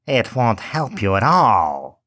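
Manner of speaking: slow, dismissive witch voice; lightly voiced heartless witch; cold old witch. A narrator's reading. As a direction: hush the weeping girl coldly. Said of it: "said the old woman".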